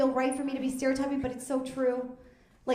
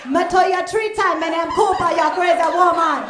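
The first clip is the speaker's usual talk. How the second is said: mock Jamaican accent; 6 semitones higher, 11 dB louder than usual. Mocking how the kids sound, in a loud hammed-up Jamaican accent. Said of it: "That's how they sound".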